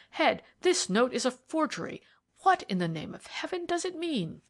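high, bewildered exclamation; growing amazement. A narrator's reading. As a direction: climb into a baffled outcry. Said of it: "with increasing amazement".

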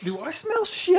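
very high, slowed mock girl voice. Friend voicing Jamie in the car, high mock girl voice. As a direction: play the suspicious girl, drawn out.

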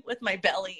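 normal talking voice

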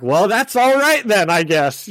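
imitação de sotaque americano, bem mais aguda e mais alta; American accent. Retold or imitated. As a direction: caricatura de sotaque americano de caubói. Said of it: "do his American accent".

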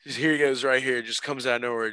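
ordinary talk, nothing marked

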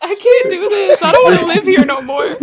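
giggly mock despair; cracking up. Real, not acted. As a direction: laugh helplessly as you give up. Speaker cracking up at own joke.

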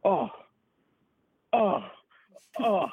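imitating announcers' short punched "oh"s; commentators' amazed reactions. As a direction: react like stunned broadcasters. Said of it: "hear Joe and Ken Danico being like".

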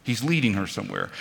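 hushed restatement to let it sink in; soft echo. Shown as a demonstration. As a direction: let it land as a quiet aside.

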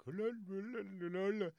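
high, mumbled nonsense syllables; put-on garbled la-la mumbling; mock mumbling.

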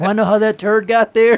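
high and loud joking setup voice; mock announcer building to a joke; hammed-up comic voice. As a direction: ham up the question like a punchline setup.

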